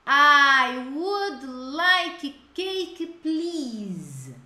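slow, loud, carefully spaced words; slow model phrase. Shown as a demonstration. Teacher modeling the phrase for students, slow and clear. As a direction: spell it out slowly for the class. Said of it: "Vamos lá".

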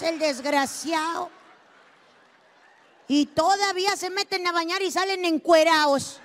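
ordinary talk, nothing marked

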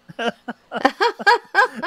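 high laugh bursting out; burst of laughter. Real, not acted. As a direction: laugh out loud at the memory. Laughs at his own impression.

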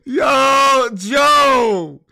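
very high, loud excited shout; real gleeful yell. Real, not acted. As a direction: yell his name in delight. Cracking up, calling out.

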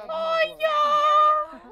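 high, stretched squeal; delighted squeal. Real, not acted. Squealing at the bar scene.

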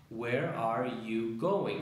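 "Where are you going?" slow, each word given; four words spoken slowly. Shown as a demonstration. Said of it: "son cuatro palabras".

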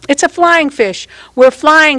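put-on high, loud, excited child's cry. Girl racing round the room with blowfish, high excited cry. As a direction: squeal it out like a kid mid-game. Child playing pretend flight.

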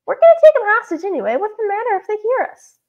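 high, squeaky crook. A narrator's reading. Robber shrugs off his partner's worry, squeaky voice. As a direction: squeak it out, pitched well up. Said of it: "squeaky voice".

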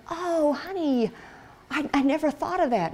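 a touch of tender surprise; wife answering husband, tender. Retold or imitated.

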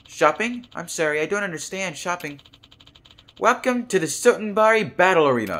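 high, loud guide robot voice; chirpy greeter robot voice. Acted. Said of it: "I'm not gonna do that voice".

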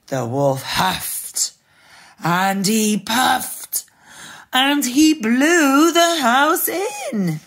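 very slow, rising build; drawn-out dramatic story narration; suspenseful storytelling. A narrator's reading. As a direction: narrate slowly, climbing to a high peak.

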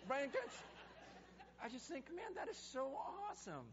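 high and loud with a laugh; thrilled. Real, not acted. Pastor telling Brian about the train sermon, high laughing delight. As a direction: gush with real delight.

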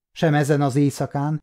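plain reading voice

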